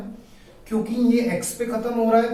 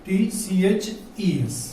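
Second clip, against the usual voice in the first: spelled out slowly; 3 dB quieter, much slower than usual. Shown as a demonstration. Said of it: "plural बनाने के लिए".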